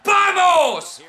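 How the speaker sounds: high, drawn-out shouts, falling at the end; triumphant shouting. Real, not acted. Gamer shouting along with the pin count, triumphant. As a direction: yell the count as if victory is sure.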